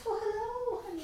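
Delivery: high, hammed-up sweet wife; mock sweet greeting. Mocking herself greeting her husband at the door, high and sweet. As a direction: gush it out like a sugary wife.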